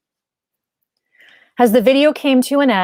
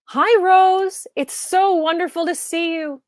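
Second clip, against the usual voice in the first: higher, lightly voiced older woman, slow at the end; old friend on video; 9 semitones higher than usual. Bee greeting Rose on a video, lightly voiced old friend.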